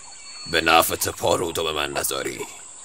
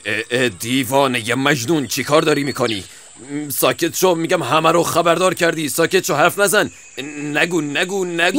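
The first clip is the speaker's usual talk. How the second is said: panicked hushing; 10 semitones higher, 5 dB louder than usual. Acted. Weasel hushing the fox.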